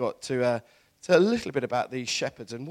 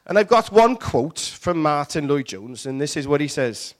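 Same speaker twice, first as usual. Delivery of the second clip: loud put-on Welsh preacher voice; playful Welsh pulpit delivery; Welsh accent; 7 dB louder than usual. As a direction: announce it in a hammed-up Welsh accent. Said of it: "I can't do it in a Welsh accent".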